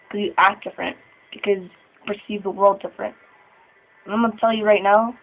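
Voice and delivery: ordinary talk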